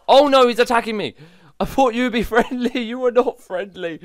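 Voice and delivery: high, loud comic panic; alarmed protest. Real, not acted. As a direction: yelp in dismay at a sudden betrayal. Game mob attacks the player.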